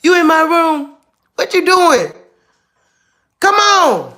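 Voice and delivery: high, loud mock telling-off; playful scolding. Real, not acted. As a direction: holler at the pet caught out. Playful scolding of found dog.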